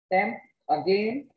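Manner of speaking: usual speaking voice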